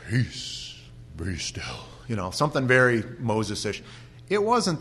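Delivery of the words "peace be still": soft, grand and stately; preacher showing the stately old wording; solemn King James. Shown as a demonstration. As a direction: intone it like Moses, softer and grand. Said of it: "King James English makes that sound very nice".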